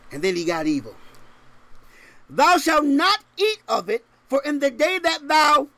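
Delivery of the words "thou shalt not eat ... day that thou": higher and louder, thundering scripture; proclaiming the command. Acted. Preacher to congregation, reading God's law in a booming voice. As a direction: boom it out like a preacher at full force.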